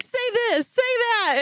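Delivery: high-pitched, eager retold begging; eager requests. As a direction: imitate yourself excitedly egging someone on. Acting out her eager requests.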